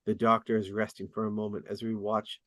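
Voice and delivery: ordinary talk, nothing marked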